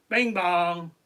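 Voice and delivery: loud, ringing "bing bong"; mock doorbell ding-dong; imitated doorbell. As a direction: call out like a doorbell chime. Imitates visitors at the door.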